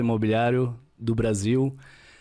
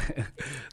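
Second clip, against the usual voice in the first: short laugh; 4 semitones higher, 9 dB quieter than usual. Real, not acted. Laughs at his own youth.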